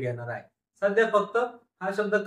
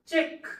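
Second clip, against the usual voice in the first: चेक said slowly and clearly, pitch up; word read out; 10 semitones higher, much slower than usual. Shown as a demonstration. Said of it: "शब्द वाचा". (चेक हा शब्द स्पष्ट उच्चारून दाखवा)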